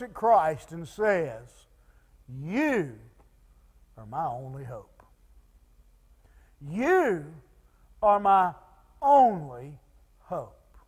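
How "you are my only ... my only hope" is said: low, hushed plea with long pauses; slow, halting, understated pleading. Retold or imitated. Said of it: "he's pleading with, with, with Jesus".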